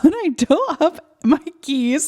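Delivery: laughing. Real, not acted. Laughing at own panic.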